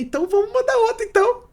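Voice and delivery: high, quick, eager outburst; retold excitement. Novice singer agreeing to another song, excited. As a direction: get carried away, pitch way up. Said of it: "Aí eu me empolguei".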